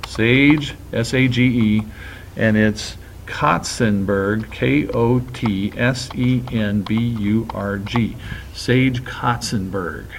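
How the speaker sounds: spelled out slowly letter by letter; drawn out as if typing along; slow dictation while typing. Shown as a demonstration. Teacher typing the name for students, slow and spelled out. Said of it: "I'm gonna type".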